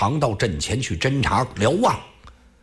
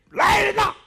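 尖声高喊来人; 山大王扯着嗓子吆喝; 喝令手下. Acted. 强盗头目喝令手下. (高声吆喝)

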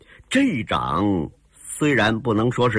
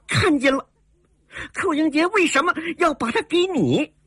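very high-pitched, urgent questioning; agitated young heroine. A narrator's reading. Heroine asks why he gave her the camel, agitated. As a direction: demand an explanation in a high girlish voice. Shaken heroine challenges the woman.

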